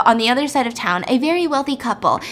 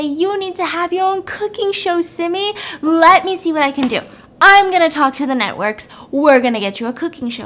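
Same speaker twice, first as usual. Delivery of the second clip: higher, mock big-shot enthusiasm; grand promising; 6 semitones higher, 3 dB louder than usual. Husband promising his wife a cooking show, grand. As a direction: pitch it grandly to her.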